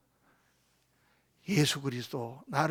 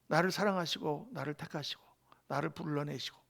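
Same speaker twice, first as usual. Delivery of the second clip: ordinary delivery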